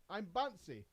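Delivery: ordinary talk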